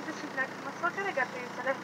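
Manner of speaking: ordinary talk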